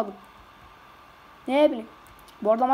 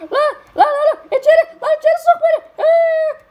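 panicked yelling; 13 semitones higher, 7 dB louder than usual. Real, not acted. Panicking while trapped in game.

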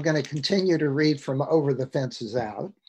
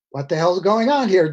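loud, demanding outburst; startled uncle demanding loudly what's happening; 3 semitones higher, 7 dB louder than usual. A narrator's reading. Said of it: "demanded Uncle Harry".

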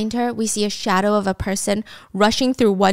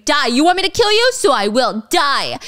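loud, pitched-up acted rage; retold scream; 4 semitones higher, 3 dB louder than usual. Attacker with hands on her throat, mock screaming. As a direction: hurl it like a strangler losing control. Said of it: "he starts screaming".

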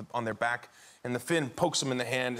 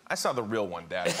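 son insisting; 6 semitones lower than usual. Retold or imitated. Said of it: "son insisting".

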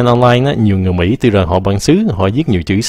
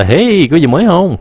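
casual greeting; 8 semitones higher than usual. Shown as a demonstration. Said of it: "What's up là".

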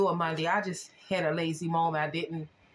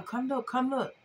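higher, eager call to come look; mechanic calling over; 7 semitones higher than usual. Retold or imitated. Mechanic calling the customer to the back, eager and higher. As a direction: beckon her over, pitched up.

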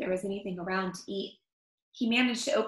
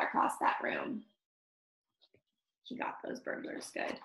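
unvoiced whispered aside; whispering; 10 dB quieter than usual. Real, not acted. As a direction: breathe it as a secret. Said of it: "hushed narrator aside".

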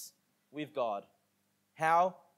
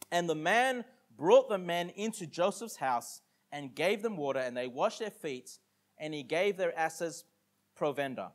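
slow, deliberate Bible reading; measured King James reading; scripture reading; 4 dB louder, slower than usual. Acted. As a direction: read the verse out to the congregation.

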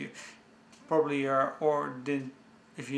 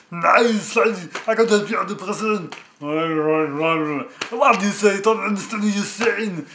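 Arnold impression; 4 semitones higher, 9 dB louder, slower than usual. Acting out the Arnold impression again.

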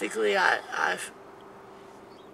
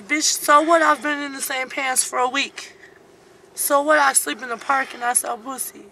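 loud, higher, defiant shouting; defiant yell; 5 semitones higher, 6 dB louder than usual. Retold or imitated. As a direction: throw it back in their face. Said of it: "I'll tell a bitch".

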